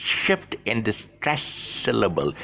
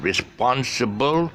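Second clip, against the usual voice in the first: "Responsible" slowed, SPON held long and higher; teacher's drawn-out "Responsible", stressed on the second syllable; 4 semitones higher, slower than usual. Shown as a demonstration. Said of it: "the stress is on response".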